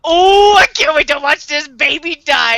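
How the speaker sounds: loud, high "oh", easing off after; hammed-up relish in mocking someone; mock gleeful anticipation. Voicing gleeful L, mocking Light.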